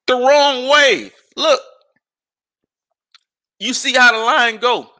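loud, slow, high exasperation; exasperated rant. Real, not acted. Vlogger ranting about fast food lines, loud slow exasperation. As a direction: stretch it out in disbelief.

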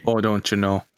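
low, put-on Minnesota accent; mock Minnesotan, pitched low; Midwestern accent. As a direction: ham up a folksy Midwestern accent. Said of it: "That's Midwestern. That's not Canadian".

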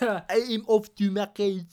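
exaggerated French villain; French accent. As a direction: echo it in a hammed-up French voice. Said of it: "the French accent".

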